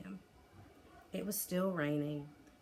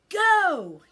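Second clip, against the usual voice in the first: huge shout, much higher and louder; yelled; 13 semitones higher, 18 dB louder than usual. A narrator's reading. Young Viking shouting a command at his stubborn dragon, full yell. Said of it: "yelled Hiccup".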